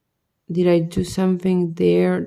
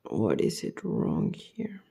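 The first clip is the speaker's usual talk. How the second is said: exhausted whisper; 10 semitones lower, 12 dB quieter than usual. Real, not acted. Whispering over the stuck piece.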